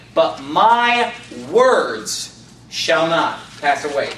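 slow scripture reading, "words" set off by a pause; stress on words. Shown as a demonstration. Preacher reading Matthew to show the emphasis, stress on "words". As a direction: hold back, then land "words" on its own. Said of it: "Notice the emphasis on the words".

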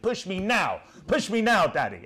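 a hint of a whiny spoilt boy; demanding kid on the swing; lightly voiced bratty child; mock child demand. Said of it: "that's literally how he shouted".

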